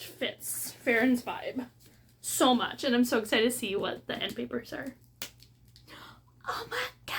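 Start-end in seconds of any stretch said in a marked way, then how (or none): 6.5-7.2 high whispered oh my god; gasped whisper. Real, not acted. Seeing the end papers, whispering in awe.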